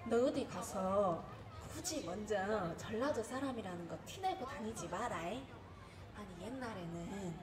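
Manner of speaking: low-key, hushed motherly caution; mom's warning. Retold or imitated. Mother to daughter before she leaves for Seoul, hushed warning. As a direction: imitate mom advising, soft and subdued. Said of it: "엄마가 나 서울 올라오기 전에 했던 말".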